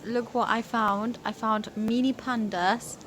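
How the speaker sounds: ordinary talk, nothing marked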